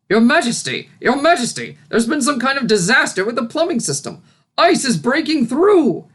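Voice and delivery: high, loud, breathless alarm; a flustered servant rushing in with bad news; flustered cry. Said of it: "he cried".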